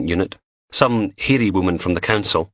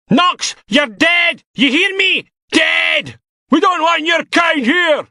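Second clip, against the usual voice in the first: high, slow, furious shouting; an enraged protester yelling at the prisoner; furious insults; 5 dB louder, slower than usual. Acted. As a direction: scream abuse at him. Protester threatening Knox.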